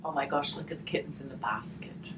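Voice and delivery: plain reading voice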